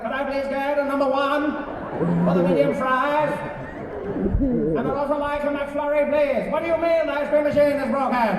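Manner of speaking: mock-oratory, pitched high and projected. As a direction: do a strange statesman voice ordering food. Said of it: "You telling me that man was in the McDonald's like".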